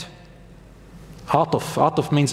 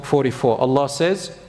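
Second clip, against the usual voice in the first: usual speaking voice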